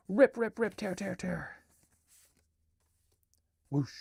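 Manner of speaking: lively storybook reading with voices; reading her picture book aloud; reading to children. Acted. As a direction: read it like bedtime to kids.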